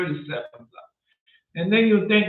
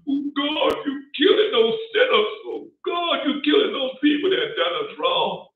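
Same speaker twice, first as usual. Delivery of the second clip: mock crying prayer; 8 semitones higher than usual. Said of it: "You think I'm gonna cry and tears?".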